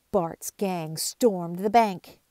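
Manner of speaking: ordinary delivery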